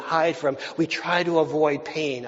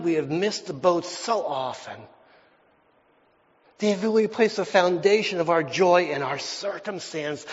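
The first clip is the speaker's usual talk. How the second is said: ordinary talk, nothing marked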